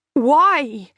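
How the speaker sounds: put-on high, loud whining little child. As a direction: whine it like a small child. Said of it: "sounded like a whining little child".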